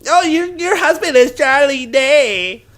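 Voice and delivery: high, slow put-on fan voice; mock gushing coworker. Coworker gushing at Leah about her husband, put-on fan voice. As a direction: gush over the husband like a starstruck fan.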